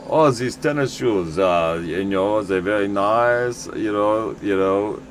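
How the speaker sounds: slow, imitated German accent. German tourist chatting about tennis shoes, imitated German accent. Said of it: "you'll meet these German guys".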